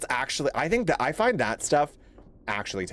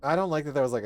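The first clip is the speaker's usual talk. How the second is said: ordinary talk, nothing marked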